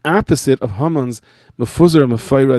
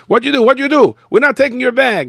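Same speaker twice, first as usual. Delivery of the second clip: put-on high, loud Mexican-accented protest; 12 semitones higher, 5 dB louder than usual. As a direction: workers refusing, Mexican accent. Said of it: "the two Mexicans come back and they say".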